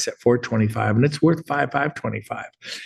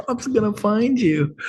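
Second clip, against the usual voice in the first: laughing; 9 semitones higher than usual. Real, not acted. Repeats the joke laughing.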